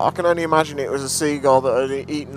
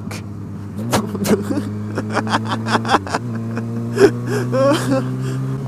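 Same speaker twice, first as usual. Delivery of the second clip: breathless laugh; 6 semitones lower than usual. Real, not acted. Laughing after swans.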